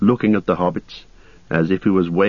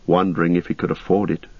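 usual speaking voice

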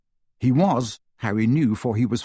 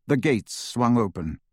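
ordinary delivery, nothing marked

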